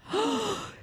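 sharp high gasp of delight; excited gasp. Retold or imitated. Kid hearing praise from his hero, a high excited gasp. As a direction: gasp like a kid who can't believe it.

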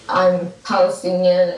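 usual speaking voice

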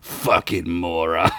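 mocking, dragged-out put-down; laughed. A narrator's reading. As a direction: draw the insult out with scorn. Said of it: "Gunk laughed".

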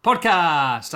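loud, high, hyped-up host welcome; booming podcast opener; excited intro. Acted. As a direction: pump up the crowd like a showman.

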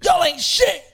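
high, loud scornful shouting; ex-prisoner screaming at students acting tough; angry retold yell. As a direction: tear into the room of kids. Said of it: "He's like".

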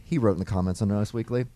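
ordinary delivery

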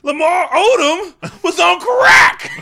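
full-blast high shouted hot take; over-the-top yelling sports commentator; Stephen A. Smith. Acted. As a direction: stretch it out in a huge pundit yell. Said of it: "'cause you yell".

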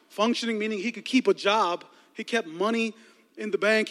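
ordinary talk

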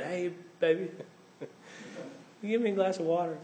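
sleepy sweet request; a hint of a drowsy spouse asking for water.

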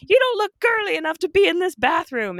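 loud, very high-pitched mocking voice; mock bathroom policing. Mimicking a feminist policing the bathroom, loud mockery. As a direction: squawk it as a caricature of a scolding gatekeeper.

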